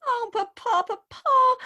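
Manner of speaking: high, loud tearful cry of Papa; wailing sob. Acted. Bride wailing to her father before the wedding, sobbing. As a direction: break into a sob on the second Papa.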